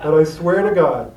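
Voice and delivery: talking through laughter, slow and loud; laughing. Real, not acted. Still laughing while retelling his reply, slow and loud. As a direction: laugh the words out slowly.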